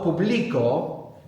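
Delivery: "publico" slowly as poo-BLEE-ko; middle-syllable stress. Shown as a demonstration. Teacher shows importance of the accent, "publico" stressed in the middle. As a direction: stretch and stress BLI in "publico". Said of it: "appreciate the importance of the accent".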